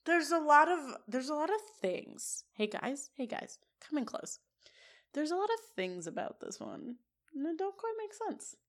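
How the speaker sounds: hushed, conspiratorial aside; mock-confidential. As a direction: draw everyone in close, voice dropped soft. Leans in to share a secret.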